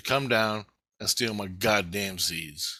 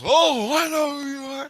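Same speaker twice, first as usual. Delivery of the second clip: loud, very high-pitched imitation; mock scientist; 6 dB louder than usual. Scientist recognizes the intruder, mock high squeal.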